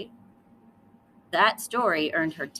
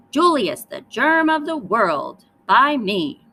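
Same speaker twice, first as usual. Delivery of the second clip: loud, slow proclamation; loud sneering proclamation; loud mock announcement; 4 semitones higher, 9 dB louder, slower than usual. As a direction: announce it grandly with scorn. Lily's mocking story opening.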